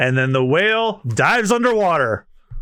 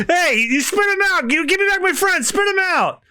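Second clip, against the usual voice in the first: Luffy shouting at whale; 14 semitones higher than usual. Acted. Luffy demanding friend back.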